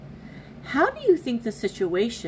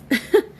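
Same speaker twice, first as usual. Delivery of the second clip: embarrassed laugh; 6 semitones higher, 7 dB louder than usual. Real, not acted. Laughs off crying, back to reading.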